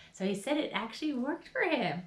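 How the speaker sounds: ordinary talk, nothing marked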